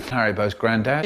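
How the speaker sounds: ordinary delivery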